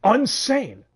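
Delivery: high, loud mock outrage; mock exclamation. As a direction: throw the word back, indignant. Echoing the accusation of insanity.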